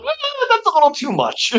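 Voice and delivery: high squeaky inner voice; a hint of a doubtful inner voice. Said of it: "I'm thinking to myself".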